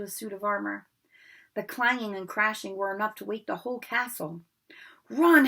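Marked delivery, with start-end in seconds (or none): 5.1-5.5 sudden loud yell; frantic cry to run. Acted. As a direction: shout the warning. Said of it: "Harry yelled".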